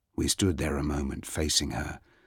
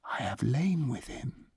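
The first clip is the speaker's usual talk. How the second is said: softer, stunned repetition; broken echo; 8 semitones higher, 4 dB quieter than usual. Acted. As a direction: say it again, hollow and lost. Repeated, broken and quieter.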